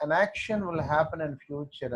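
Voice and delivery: very slow, every word separate; slow quoting. Shown as a demonstration. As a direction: spell the phrase out at dictation speed. Teacher quotes rule slowly.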